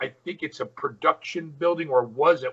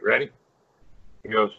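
ordinary delivery

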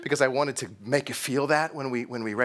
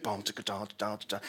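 imitated movie beat; 7 semitones lower, 10 dB quieter, faster than usual.

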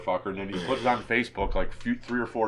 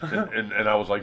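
ordinary delivery

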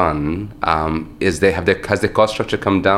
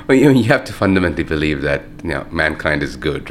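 ordinary talk, nothing marked

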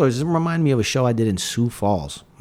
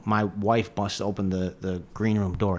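ordinary talk, nothing marked

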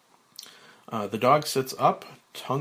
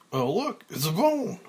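higher, mock-surprised kids' show host; cartoonish mailman; 5 semitones higher, 4 dB louder than usual. Mike pulls out the bone, cartoonish mock surprise. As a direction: play the big reveal for children. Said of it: "at a cartoonish Mike the Mailman".

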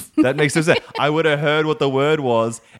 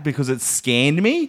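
normal talking voice

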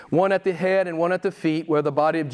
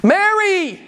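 high, loud tender call of her name; Jesus calling her name; 5 dB louder than usual. Acted. Jesus calls to weeping Mary at the tomb, high ringing call. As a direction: call Mary's name as only Jesus could. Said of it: "nobody could say her name like him".

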